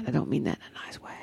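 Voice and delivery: quick, hushed whisper, dropping the voice sharply; a fast conspiratorial whisper; catty whisper. Real, not acted. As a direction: share it like a naughty secret. Catty aside about partner's dad.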